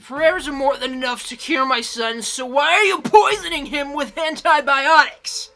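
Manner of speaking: furious, shouted accusation; angry scream. Acted. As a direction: scream it at the priest in rage. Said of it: "she screamed".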